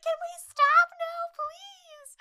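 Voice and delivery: high, drawn-out mock wail; hammed-up pleading, loud then fading; distressed skier. Girl on skis begs the boat to stop, mock wailing. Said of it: "she's clearly going the fuck through it".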